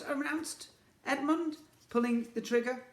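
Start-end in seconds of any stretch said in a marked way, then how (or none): none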